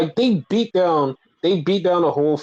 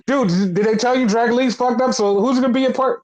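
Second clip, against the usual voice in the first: higher, quick Carlito impression; a lightly voiced, higher Carlito; imitating Carlito; 7 semitones higher, faster than usual. Carlito barging in, asking about the match, higher voice. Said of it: "Carlito runs in and was like".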